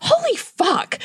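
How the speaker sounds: high, astonished holy fuck; stunned exclamation. Retold or imitated. Woman telling a friend how stunned she was, high and astonished. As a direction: blurt it out in disbelief. Retelling her inner reaction.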